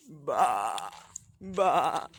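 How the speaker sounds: mock bleat, like a sheep. Bleating back at the sheep he just found. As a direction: baa at the sheep like one of them.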